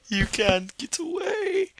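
very high, slow sing-song taunt; high creepy killer. Pretend. Killer to a fleeing victim, high crazed taunt.

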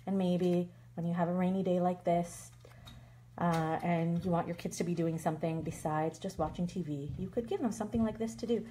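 usual speaking voice

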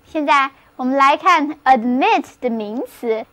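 usual speaking voice

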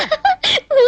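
short high-pitched laugh; high giggle. Acted. Girl amused by the visitor at her door, giggling. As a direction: burst into a quick giggle. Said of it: "ఏమిటా నవ్వు".

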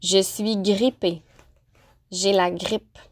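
normal talking voice

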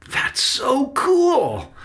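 put-on high, drawn-out kid-like awe; excited exclamation. A narrator's reading. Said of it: "Benny exclaimed".

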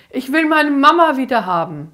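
high, very loud sobbing plea; desperate scream. Acted. Amy screams that she wants her mother back, very loud. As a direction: scream it out, wanting mum back. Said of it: "Amy schrie".

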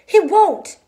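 high, loud frantic denial; frantic cry. A narrator's reading. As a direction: cry out in protest, pitch leaping up. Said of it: "Lenny cried frantically".

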